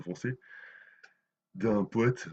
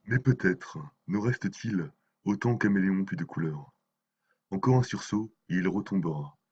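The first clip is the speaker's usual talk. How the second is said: solemn, measured poem reading; dramatic poem, phrase by phrase; poetry recitation; 5 dB louder than usual. Acted. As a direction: recite the verse with weight, pausing between phrases. Recites translated poems by Mayakovsky.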